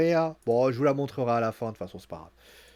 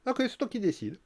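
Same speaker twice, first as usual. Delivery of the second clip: "Ok, c'est toi qui décides" pitched high and a little softer, mock sweet; mock-sweet surrender; high sarcastic concession; 8 semitones higher, 4 dB quieter than usual. Becky teasing Bayley again, high mock-sweet sarcasm. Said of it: "Je lui fais".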